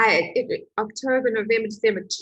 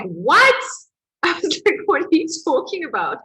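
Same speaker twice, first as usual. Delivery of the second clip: indignant high "what", then fast; stunned retort; 6 semitones higher, 4 dB louder than usual. Retold or imitated. Retelling her shock at counselor's question, indignant. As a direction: disbelief thrown back at him. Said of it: "And I was like".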